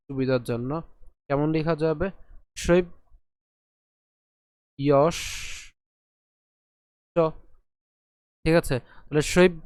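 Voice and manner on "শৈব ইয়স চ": শৈব, ইয়স, চ with long pauses between; broken into syllables. Shown as a demonstration. Teacher splitting it for convenience, শৈব ইয়স চ in separate chunks. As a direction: isolate each piece of শৈব ইয়স চ. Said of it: "একে যদি ভেঙে লিখি".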